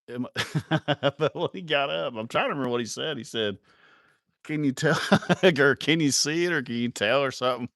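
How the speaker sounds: tickled by your own story; cracking up. Real, not acted. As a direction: struggle to get the quote out through laughter.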